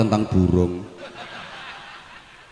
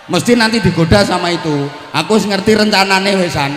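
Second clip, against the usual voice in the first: animated warning; 6 semitones higher than usual. Real, not acted. Teacher warns about teasing.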